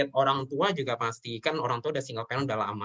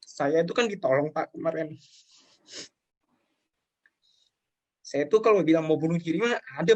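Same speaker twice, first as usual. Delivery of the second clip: tearful confession; 2 semitones higher than usual. Real, not acted. Telling the host about his darkest time, tearfully. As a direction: push through tears while confessing. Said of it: "Just take your time".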